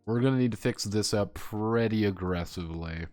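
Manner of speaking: usual speaking voice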